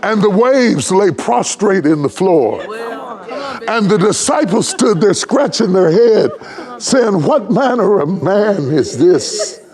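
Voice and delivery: full-throated sermon crescendo; preaching at full force. Acted. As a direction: ride the melody up and let it ring. Preacher whooping at climax.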